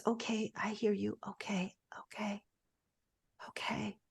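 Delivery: breathy whisper, fading into nothing; whispered reassurance. Acted. Heart whispers back to the listener, soft whisper. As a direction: hush it like soothing a child.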